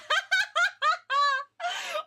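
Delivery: very high squealing laughter; hysterical laugh. Real, not acted. Streamer hysterical over her casting idea, squealing laughter. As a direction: laugh helplessly at the top of your range.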